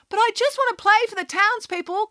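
a hurt pig calling up to the wall; pleading pig. A narrator's reading. Gwyneth pleading at gate.

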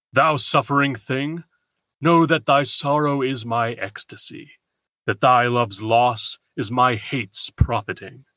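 stately god's voice in verse recitation; vengeful god. Acted. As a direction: call down deliberately as a mocking god. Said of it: "some vengeful god would call".

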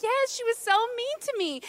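high-pitched, mock-aggrieved complaint; frustrated. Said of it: "I'm frustrated".

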